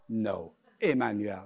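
mildly weary, low correction. Acted. Customer correcting barista on his name, low weary reply. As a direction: drop low to correct them.

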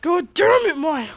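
high, loud angry outburst; angry curse. Acted. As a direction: curse at her in full exasperation. Mom role cursing at daughter.

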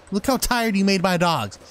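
usual speaking voice

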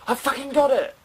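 high, loud victory shout; real whoop of triumph; triumphant yell. Real, not acted. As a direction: explode with relief at finally getting it. Finally succeeds in the game.

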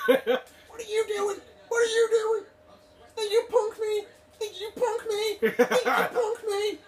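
very high, squealed challenge; squeaky mock outrage. Squaring up for a play fight, high squeaky mock outrage.

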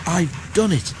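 winded but triumphant; gasping triumph. A narrator's reading. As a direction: gasp it out triumphantly. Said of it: "he gasped triumphantly".

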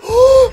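sharp, very high loud gasp; surprised gasp. Real, not acted. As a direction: gasp at the sudden achievement. Gets surprise achievement. (yllättynyt henkäys)

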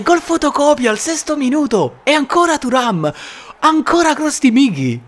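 shouted, high goal commentary; breathless italian goal commentary. Real, not acted. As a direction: scream the repeat goal in disbelief. Second goal call, shouting.

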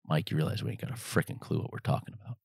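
quietly whispered aside to a partner; hushed confession. Retold or imitated. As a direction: let it out under your breath, like a guilty secret. Frank's confession, retold in the balcony.

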